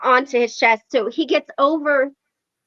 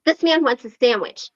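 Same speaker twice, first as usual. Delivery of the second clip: ordinary talk, nothing marked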